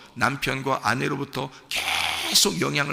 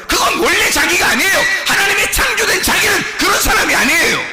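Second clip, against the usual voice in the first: high, loud, forceful sermon; full-force preaching; 13 semitones higher, 7 dB louder than usual. Real, not acted. Preacher telling the congregation the world made this self, full-force preaching. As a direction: drive each point home at full cry.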